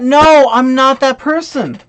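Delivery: firm, irritated rejection; emphatic refusal. Real, not acted. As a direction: drag out the "no" indignantly. Rejects the mocked role.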